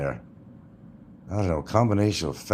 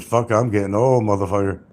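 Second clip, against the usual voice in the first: mock dismay; 4 dB louder than usual. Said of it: "I look in the mirror and I said".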